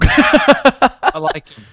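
slow, high, loud silly voice; cartoon voice. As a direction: stretch it out in a goofy voice. Joking about pet snake.

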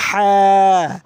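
হা pressed loud from mid-throat, breath flowing; চাপ দিয়ে হা; forceful throat ha. Shown as a demonstration. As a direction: squeeze হা from the throat, air kept flowing. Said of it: "এই হা বলতে হবে গলার মাঝখান থেকে".

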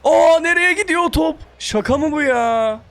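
real high-pitched protest at a miss; incredulous outburst. Real, not acted. Gamer watches his shot miss an empty goal, incredulous outburst.

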